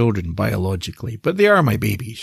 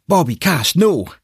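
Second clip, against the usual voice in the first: sharp command; 8 semitones higher than usual. Acted. Narrator calls pets to stop.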